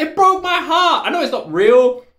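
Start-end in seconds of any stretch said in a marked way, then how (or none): 0.0-1.1 high, slow, pleading wail; hammed-up heartbreak, drawn out; heartbroken protest. Heartbroken reaction, repeated.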